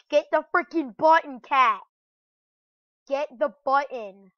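impatient gamer yell; frustrated yelling. Real, not acted. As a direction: urge it on in loud exasperation. Yelling at game cat to press button.

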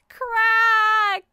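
the word crack said high and loud. A narrator's reading. Narrator reading to a child, loud crack.

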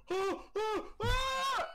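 high yelping cries rising to a shout; panicked yelps. Retold or imitated. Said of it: "I didn't scream".

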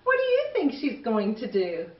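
plain reading voice

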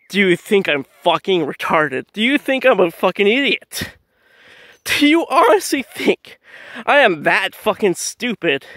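rising pitch, incredulous challenge; indignant retort. Retold or imitated. Acted indignant retort to stranger.

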